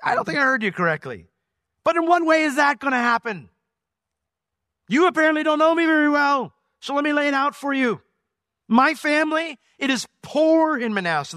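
high, loud disbelieving protest; incredulous Gideon. Retold or imitated. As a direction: play it as a stunned, protesting Gideon. Gideon's incredulous paraphrase to God.

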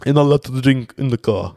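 lightly put-on Russian accent, driver scolding; mock Russian accent, a strict Uber driver; Russian-accented driver telling them off. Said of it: "I don't know why they went Russian".

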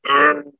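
sharp high groan of disgust; a quick exasperated groan; frustrated groan. Retold or imitated. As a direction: let out a high, pained ugh. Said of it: "what came alive was like".

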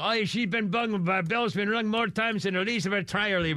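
rough imitation of an Irish accent; mock Irish accent. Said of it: "give her a freaking Irish accent".

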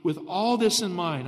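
usual speaking voice

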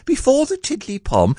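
very high, small squeak; puzzled Piglet voice. A narrator's reading. Piglet tries to follow Pooh, squeaking. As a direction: ask it in a tiny high voice.

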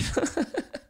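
airy laugh pitched high with no voice; breathless high giggle. Real, not acted. To his listeners after a joke, a breathless high giggle. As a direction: giggle almost silently, high up.

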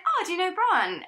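chirpy small talk to a stranger; friendly chirp. Retold or imitated. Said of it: "I was like".

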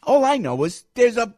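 high, loud exasperated outburst; exasperated shout. Real, not acted. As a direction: throw up your hands and shout it. Exasperated rant about balloon.

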